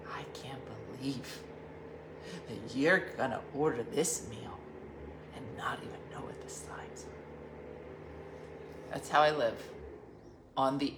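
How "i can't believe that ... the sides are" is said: mock outraged whisper. Diana scolding Dean about the sides, in a whisper. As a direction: whisper it as if scandalised. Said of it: "she got real mad".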